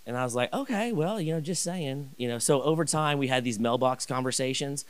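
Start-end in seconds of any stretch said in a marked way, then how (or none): 0.5-2.1 high, trailing, softer okay; sheepish backing off. Retold or imitated. After Larry's refusal, a high sheepish retreat. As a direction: retreat with a shrug.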